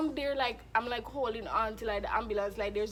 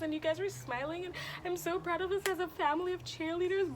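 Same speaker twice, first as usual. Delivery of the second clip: high-pitched, hammed-up squeal of team pride; excited gushing; 8 semitones higher than usual. Cheerleader gushing to the squad after routine, excited gushing. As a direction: imitate a thrilled teammate gushing nonstop.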